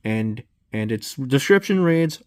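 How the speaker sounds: ordinary delivery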